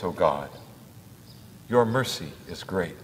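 ordinary talk, nothing marked

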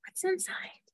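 sharp gasp, then a high breathless whisper; whispered wonder to little listeners. Acted. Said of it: "hushed surprise for children".

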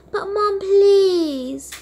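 slow high whining please; drawn-out plea. Acted. Daughter's last plea to mom before going home. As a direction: stretch out the begging.

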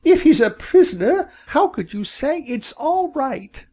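high, fretful mother bird; high-pitched storybook dismay; crying out in protest. A narrator's reading. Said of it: "cried Missus Bob".

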